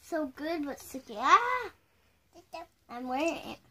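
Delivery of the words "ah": surprised yelp. Real, not acted. Candy stuck on chin.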